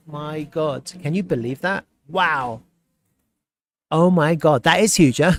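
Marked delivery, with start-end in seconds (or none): 3.9-5.3 loud, thrilled disbelief; amazed excitement. Real, not acted. Streamer reacts to the huge new listing, amazed and excited. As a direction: exclaim it loudly, breaking into a laugh after.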